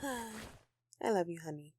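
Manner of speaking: slow, soft loving sigh; tender. Acted. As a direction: sigh it out fondly to your partner. Tender declaration to partner.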